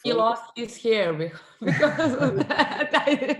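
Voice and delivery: laughing through the words, pitch up; real laughter breaking into the line. Real, not acted. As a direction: giggle out the joke about his hair. Joking about colleague losing hair.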